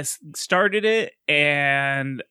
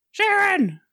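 high-pitched mock scream of a name; screamed-style call, pitched way up; 14 semitones higher than usual.

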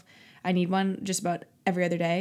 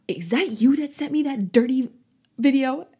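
a louder, higher confronting Karen voice; inner Karen; 5 semitones higher, 4 dB louder than usual. Retold or imitated. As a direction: rip into the kids like an offended Karen. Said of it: "pulled out my inner Karen".

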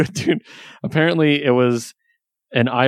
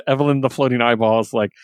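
ordinary delivery

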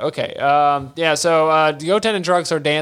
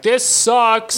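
higher, louder gripe from the back; Bulma complaining; 9 semitones higher, 4 dB louder than usual. Retold or imitated. Bulma griping from the back, high complaint. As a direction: voice a fed-up woman griping. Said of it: "she's like".

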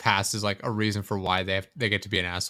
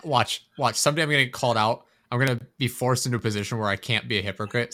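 ordinary talk, nothing marked

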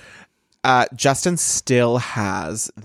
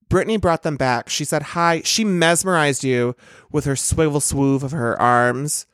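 usual speaking voice

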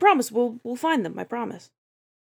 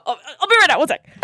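higher, louder call through a door; 8 semitones higher, 6 dB louder, faster than usual. Acted. Player calling out to the waiting woman, louder call. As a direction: call out so she hears you.

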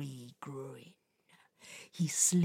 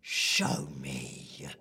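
much lower, quiet magic command; low and quiet, casting a spell; deep spell command; 5 dB quieter than usual. Acted. Spirit commanding magic pool.

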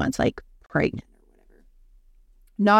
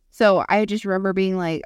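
ordinary delivery

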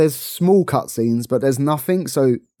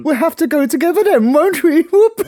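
hammed-up pantomime line, much higher and louder; playful mock panto sing-song, far higher pitched; panto call; 5 dB louder than usual. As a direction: tease him like a panto dame egging on the crowd. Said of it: "that's what it says in the Panto".

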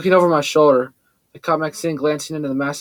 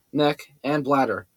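plain reading voice

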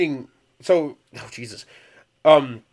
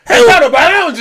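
high, loud mock exclamation; big parody catchphrase shout; hammed-up TV host shout; 13 semitones higher, 11 dB louder than usual. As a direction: yell it like an over-excited food show host. Parody TV host wielding ice pick.

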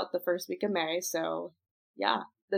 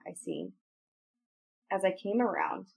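usual speaking voice

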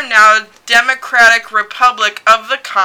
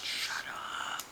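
whisper; 22 dB quieter than usual. Real, not acted. Hushing friend about location.

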